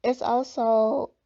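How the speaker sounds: slow words around a piece of candy; mouth full. Real, not acted. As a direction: talk with a candy in your mouth. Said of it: "I got a piece of candy in my mouth".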